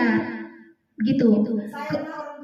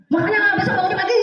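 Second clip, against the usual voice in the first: higher, half-laughing scold to a late pupil; scolding intonation; 5 semitones higher than usual. Shown as a demonstration. Teacher scolding a late pupil, higher and laughing. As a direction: tease the pupil with a light scold. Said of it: "Beda intonasi".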